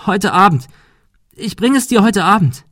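high-pitched desperate promise; tearful pleading. A narrator's reading. Girl promises the bully money tonight, tearful. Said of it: "weinte sie".

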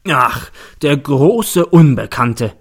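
slow, loud, drawn-out mockery; mocking. A narrator's reading. Prosecutor scoffs at the girl's story, mocking. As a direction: stretch every word out loudly in mockery. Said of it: "spöttelt der Staatsanwalt".

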